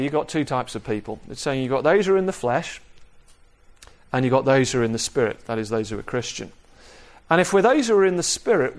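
normal talking voice

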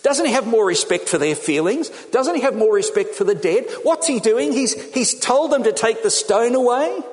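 high, appalled string of questions; scandalised onlooker. Acted. An embarrassed observer at Lazarus's tomb, scandalised. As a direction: fret in rising pitch like a mortified bystander. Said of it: "hey, you'd say".

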